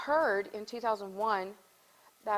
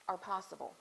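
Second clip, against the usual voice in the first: normal talking voice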